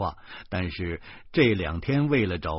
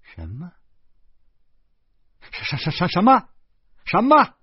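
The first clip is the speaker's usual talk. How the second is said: much higher, loud, stammering disbelief; stunned shock; 10 dB louder than usual. Acted. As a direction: stutter out the question in shock. Shocked by news of a death.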